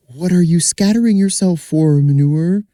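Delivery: lightly voiced oak asking; oak tree voice.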